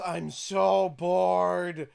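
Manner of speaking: bored drawl. Retold or imitated. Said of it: "He's like".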